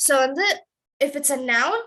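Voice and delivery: usual speaking voice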